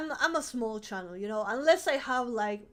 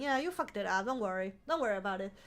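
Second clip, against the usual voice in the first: usual speaking voice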